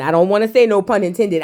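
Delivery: ordinary talk, nothing marked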